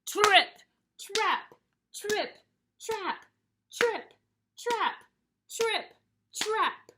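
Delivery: high, loud trip-trap; heavy hoofbeat words, pitched high; big goat's footsteps. Acted. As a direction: stamp out each word loudly. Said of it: "Here we go, ready?".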